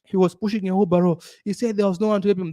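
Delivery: lightly voiced tattling relative; gossiping relatives. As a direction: relatives mocking the son's radio story to his mom. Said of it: "They call my mom and say".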